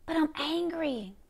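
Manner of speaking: high, soft admission of anger; hushed, pained acted confession; subdued angry confession. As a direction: drop the volume but keep the pitch up, confessing. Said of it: "And you angry. You're saying".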